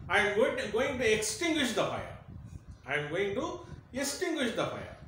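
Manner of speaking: a small creature insisting it will fight the fire; determined little bat. Acted. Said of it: "The bats said".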